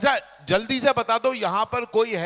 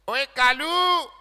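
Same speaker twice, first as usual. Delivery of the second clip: mock street taunt; 6 semitones higher, 4 dB louder, much slower than usual. Strangers calling him in Delhi.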